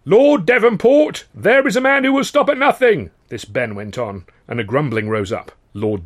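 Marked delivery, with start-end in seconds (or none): 0.0-3.1 high, loud rabble-rousing oration; fiery speech. Acted. Union orator warning dockers about the owners, loud and fiery. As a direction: whip the crowd into fury.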